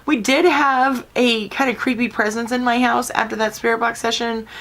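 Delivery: usual speaking voice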